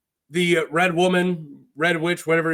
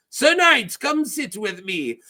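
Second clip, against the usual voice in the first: put-on foreign pirate accent, pitched up at first; Salladhor Saan; 5 semitones higher than usual. Pirate beckons Davos over to join him, accented and theatrical. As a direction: flamboyant pirate with an exotic accent. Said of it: "what I'm gonna do with the accent".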